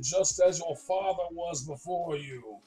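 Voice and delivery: put-on grand voice of a deity; celestial beings' voice. Celestial beings addressing Jack as chosen, put-on pronouncing voice. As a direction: imitate solemn gods pronouncing someone worthy. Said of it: "And they basically say".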